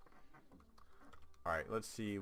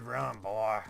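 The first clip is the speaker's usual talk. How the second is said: put-on a creepy deep Southern accent, louder; slightly creepy deep accent; 6 dB louder than usual. Quoting the enemy player's taunt in a creepy Southern accent. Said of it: "Like, slightly creepy, deep accent".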